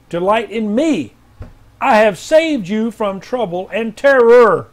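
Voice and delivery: slow, lifted, solemn declaration; prophetic proclamation. Acted. Pastor reading Tanika's word from the Lord, slow and solemn. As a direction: speak it as God's own voice, slowly.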